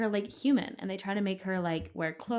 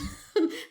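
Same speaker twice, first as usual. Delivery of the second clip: dolphin squeak; 9 semitones higher, 3 dB louder than usual. Pretend. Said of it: "Let me try that again".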